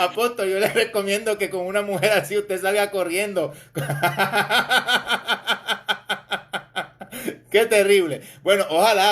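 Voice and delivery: advice delivered through a laugh; amused, chuckling between words. Real, not acted. Host laughing as he advises the Apostle to run. Joking advice after a silly song.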